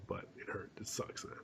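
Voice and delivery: breathy whispered complaint. Real, not acted. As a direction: drop to a whisper. Complaining about being sick.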